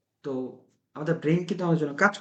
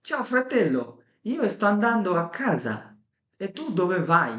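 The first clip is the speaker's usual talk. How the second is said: slow, carefully articulated Italian with lively Italian melody; Italian demo speech; 3 semitones higher, much slower than usual. Shown as a demonstration. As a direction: show how the mouth moves in Italian. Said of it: "যেমন বললাম".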